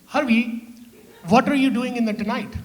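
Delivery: put-on loving mom in a thick Punjabi accent; mom's Punjabi accent. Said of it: "She's like".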